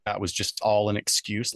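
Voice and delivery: ordinary talk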